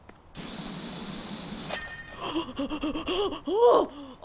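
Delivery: big rising gasp of delight; real gasp of joy; loud excited gasp. Real, not acted. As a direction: gasp at the discovery. Finding the missing spot.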